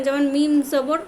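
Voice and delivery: ordinary talk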